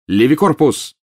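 loud, high spell cry; spell shout. Acted. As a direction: fire off the spell. Spell cast in fight.

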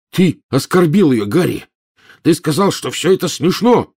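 high, loud furious yelling; angry shout. Acted. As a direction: scream the accusation. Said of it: "закричал Рон".

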